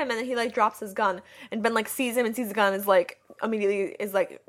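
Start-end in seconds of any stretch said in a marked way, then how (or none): none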